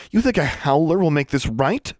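high, loud indignant question; incredulous anger. Acted. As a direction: challenge them in outrage. Furious retort to proposed punishment.

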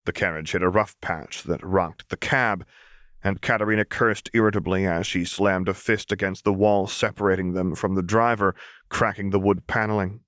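usual speaking voice

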